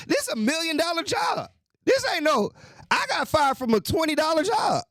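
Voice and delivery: worked-up shouting rant; heated rant. Real, not acted. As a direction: yell the outrage. Ranting about getting fired.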